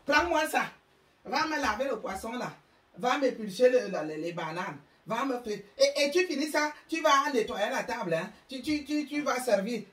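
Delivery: put-on high, loud commands to a child servant; a mother barking chores the moment she walks in; bossy mother ordering. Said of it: "la maman arrive comme ça".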